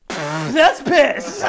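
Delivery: spit-out, loud, high realisation; imitating a guy's shocked splutter; spluttering prank victim. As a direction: splutter it out like you just tasted it. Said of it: "And he goes".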